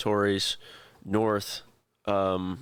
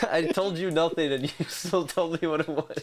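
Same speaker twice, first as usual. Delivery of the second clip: laughing; 7 semitones higher than usual. Real, not acted. Laughing at partner's guess.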